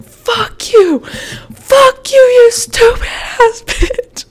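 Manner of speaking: shrieked high, hammed-up insult; retold screaming. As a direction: reenact screeching abuse, stretching the last words. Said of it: "I'm just screaming".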